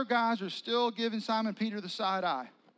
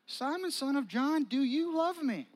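pressing question; 8 semitones higher, 5 dB louder than usual. Acted. Third time asked.

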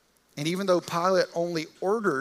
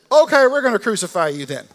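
loud, higher punchline voice; comic verdict; 7 semitones higher, 13 dB louder than usual. Retold or imitated. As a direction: snap back to the grim payoff. Pilate's deadpan verdict punchline.

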